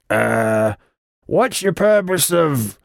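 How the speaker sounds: slow, high-pitched goblin; halting, high goblin officialese; goblin playing official. A narrator's reading. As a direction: grope for the formal phrase as a goblin. Goblin attempts official question.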